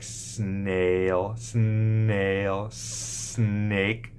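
snail and snake said slowly, s held; slow modeled words. Shown as a demonstration. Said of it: "put an S N ... sound together".

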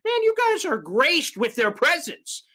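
sarcastic starstruck reporter impression; mocking fawning media voice. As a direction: sneer at the press by gushing in a silly high voice. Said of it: "It said".

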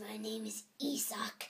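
whispered bad German accent. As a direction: hush a mock German. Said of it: "try German".

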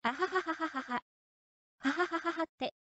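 soft, airy mock cackle, a touch unsettling; a hushed imitation of eerie laughing; retold creepy laugh. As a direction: quietly imitate a strange man's laughter. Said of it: "Bさんの笑い声が聞こえた".

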